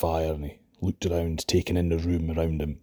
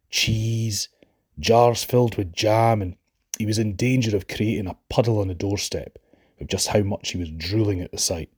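ordinary delivery, nothing marked

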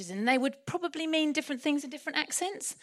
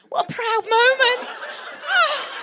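gushing pride; 12 semitones higher, 8 dB louder than usual. Real, not acted. Gushing over proud parents.

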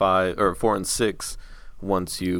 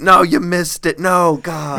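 a fan yelping as his favourite game is botched; freaking out; 8 semitones higher, slower than usual. Retold or imitated. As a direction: play the friend freaking out over a missed cue. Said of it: "he's just freaking out next to you".

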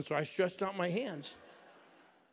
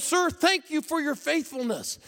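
loud, higher, slow thanks; preacher's praise; 13 semitones higher, 8 dB louder than usual. Retold or imitated. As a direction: thank him grandly from the pulpit. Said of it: "He goes".

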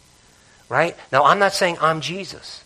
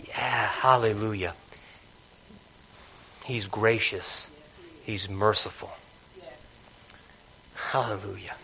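soft worship; 3 semitones lower, 6 dB quieter than usual. Real, not acted.

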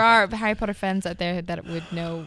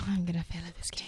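sigh, then whisper resignedly; soft, breath-only muttered worry; defeated whisper; 2 semitones lower, 8 dB quieter, faster than usual. Real, not acted. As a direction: whisper it like you're losing hope. Contestant doubting her answer.

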